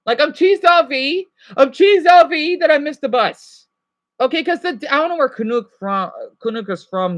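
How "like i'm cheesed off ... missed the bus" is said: louder, higher Canadian "I'm cheesed off, eh?"; hammed-up Canadian gripe; Canadian accent with "eh". Shown as a demonstration. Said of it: "Canadian English, eh?".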